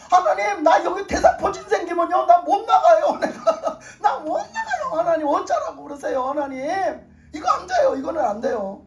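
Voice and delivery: high, laughing desperate begging; laughing plea. Retold or imitated. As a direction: plead while laughing at yourself. Retold pleading, laughing.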